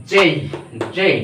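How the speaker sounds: যেই with a glide into i; correct letter J. Shown as a demonstration. Teacher reviewing tricky letters, gliding যেই. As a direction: end যেই on an ee sound. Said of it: "শব্দগুলো আবার আমি একটু".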